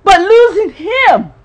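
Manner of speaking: high, loud building cry of loss; grieving mother listing losses, building to a cry; rising lament. Real, not acted. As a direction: preach your grief to the rafters.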